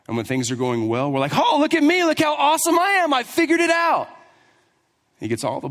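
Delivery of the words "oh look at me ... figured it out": high, fast, smug show-off voice; hammed-up self-praise, pitched way up; mock boasting. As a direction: crow about yourself like a braggart. Mocking people who boast.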